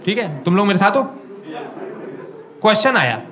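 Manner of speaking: ordinary talk, nothing marked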